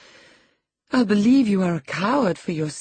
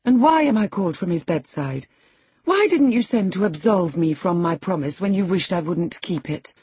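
raised, insistent scolding; impatient demand; 5 semitones higher, 5 dB louder than usual. A narrator's reading. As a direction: demand answers, out of patience. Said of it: "his cousin's patience was not sufficient to endure".

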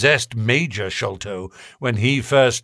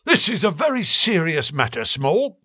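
cold probing inspector; 7 semitones higher than usual. A narrator's reading. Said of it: "said the detective".

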